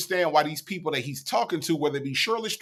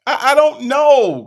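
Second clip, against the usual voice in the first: high, loud, drawn-out cry; exasperated bafflement; 8 semitones higher, 10 dB louder, slower than usual. Real, not acted. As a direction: wail it slowly in bafflement. Exasperated bafflement after replay.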